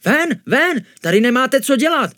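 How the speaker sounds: high, loud shouted order; angry shooing. A narrator's reading. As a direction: chase intruders out with a scolding yell. Innkeeper chases intruders out. (rozzlobeně, křikem vyhání)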